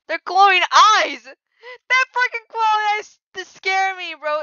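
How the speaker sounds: loud, high, drawn-out wail; high-pitched exasperated yell; scared outcry. Real, not acted. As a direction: cry out in fright, stretching the words. Frightened rant at zombies' glowing eyes.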